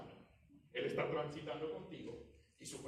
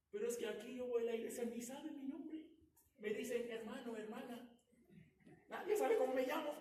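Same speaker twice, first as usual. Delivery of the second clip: higher, quicker complaining voice; mock complaining churchgoer; 11 semitones higher than usual. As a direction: whine about nobody knowing your name. Imita queja de un congregante. (imitación aguda de un feligrés quejoso)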